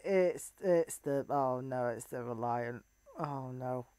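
low, very soft, sinking murmur; deflated, barely voiced dread; quiet dread. Real, not acted. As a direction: trail down into hushed dismay. Dreading the Reliant in game.

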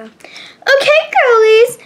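high, loud call to the class; dance teacher. Acted. Mister Soto calling the girls to start, high teacher call. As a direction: sing out brightly to round up the students.

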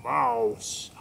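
deep, drawn-out call; mysterious frog voice. Acted. Unseen magic frog calls to the mouse, deep mysterious voice. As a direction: summon the mouse in a low voice. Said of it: "And the voice said this".

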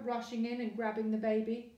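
usual speaking voice

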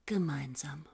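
soft slow breathy whisper; hushed and slow, just for him. Acted. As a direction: whisper it close to his ear. Intimate talk with imagined Gottfried.

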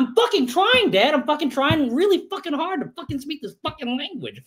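high, whiny mock protest; boy protesting to his dad, high and whiny; frustrated kid. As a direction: play the kid pleading he's trying. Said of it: "they would come home and like".

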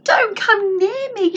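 high, loud, drawn-out warning. A narrator's reading. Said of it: "she warned him".

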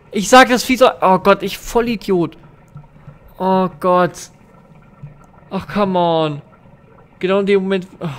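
high, loud startled outburst; startled exasperation. Real, not acted. Gamer caught by the monster mid-sentence, startled and exasperated. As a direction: cry out in exasperated self-reproach. Jump scare death, blames himself.

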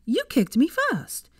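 put-on childish indignant comeback; slow high huffy protest; indignant retort. Hermione retorting to Malfoy.

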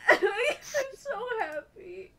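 tearful laugh into the words; real joyful weeping; crying from happiness. Real, not acted. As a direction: say it through happy sobs. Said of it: "I'm actually crying".

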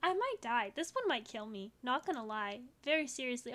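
ordinary talk

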